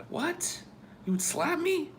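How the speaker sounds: squeaky shocked disbelief; shocked rising question; surprised. Real, not acted. Shocked at friend's answer.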